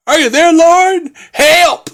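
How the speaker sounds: high, loud desperate cry; hammed-up panicked plea to heaven; Dave Chappelle impression. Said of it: "he just goes, like, full, like, Dave Chappelle".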